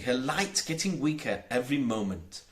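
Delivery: plain reading voice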